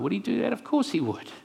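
normal talking voice

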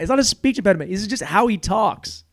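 ordinary talk

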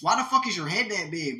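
ordinary delivery

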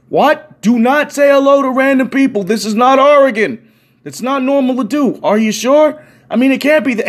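high, loud telling-off; exasperated scolding. Retold or imitated. Storyteller scolding newcomer for greeting strangers, exasperated.

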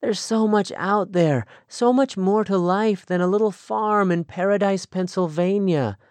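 put-on young woman longing for the world, excited. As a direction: pour out her dreams with growing eagerness. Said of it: "there was excitement in her voice".

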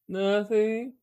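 high, rising denial; feigned innocence. Retold or imitated. Wife denying her pun to husband, high innocent imitation. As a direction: deny it in a lilting put-on voice.

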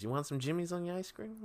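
high-voiced mom with a Boston accent. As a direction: cheerily offer it as a Boston mother. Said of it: "moved over to Monrovia from Boston".

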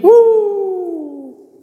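a sudden high squealed "uh"; mock falsetto yelp.

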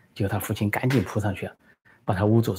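ordinary delivery

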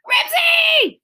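very high, loud cry of the name; desperate shout. A narrator's reading. Henry sees Ribsy leaving, desperate shout. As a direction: shout desperately after the dog. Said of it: "shouted Henry with a sinking feeling".

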